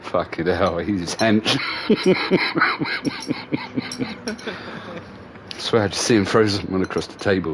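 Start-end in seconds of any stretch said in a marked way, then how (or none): 1.6-5.0 high, wheezy laugh dying away softly; amused laughter. Real, not acted. Reacting to someone thrown across a table, soft high laughter. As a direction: laugh on until it thins to a wheeze. Amused by what he just saw.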